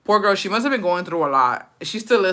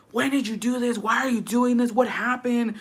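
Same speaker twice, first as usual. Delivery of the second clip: higher-pitched imitation of worried questioning; mock concerned boyfriend; 5 semitones higher, 3 dB quieter than usual. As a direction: put on a fretting boyfriend's voice. Voicing boyfriend's alarmed questions to girl.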